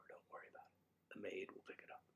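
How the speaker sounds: hushed whisper. Pretend. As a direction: whisper it like a secret. Mock-posh aside about the maid.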